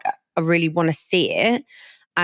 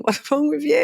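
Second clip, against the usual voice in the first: laughing disbelief; 9 semitones higher than usual. Retold or imitated. Friend laughing at her.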